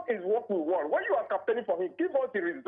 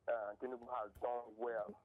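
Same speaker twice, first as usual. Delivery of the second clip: faint caller on a bad phone line; very faint, distant phone voice; barely audible; 10 semitones lower, 14 dB quieter than usual. Real, not acted. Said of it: "We can barely hear you".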